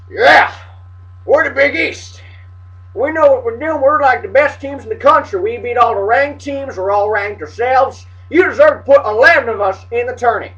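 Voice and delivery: cocky put-on Southern accent. Voicing the Big East bragging before the tournament, Southern accent. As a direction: boast like a Southern good ol' team. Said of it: "I'm talking a Southern accent".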